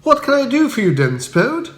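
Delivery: high, bright upper-class chirp; cheery Bertie. Acted. Bertie greeting Spode at the door, high and breezy. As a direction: greet him breezily, unbothered. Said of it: "I inquired, trying to be helpful".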